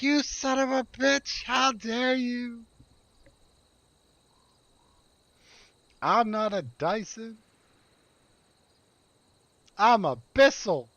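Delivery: high mock-offended rant, a hint of anger; mock outrage. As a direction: play an insulted vacuum cleaner. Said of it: "slamming that keyboard like".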